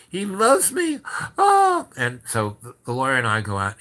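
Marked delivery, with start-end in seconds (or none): 0.1-1.9 high, loud mock swoon; overjoyed swoon. Madame hears she is loved, hammed-up thrilled squeal. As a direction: imitate her squeal of delight, far up in pitch.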